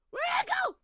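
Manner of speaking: very high, loud screamed question; piercing cry of disbelief; anguished shriek. Real, not acted. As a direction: shriek it in panic. Streamer cannot find closed game.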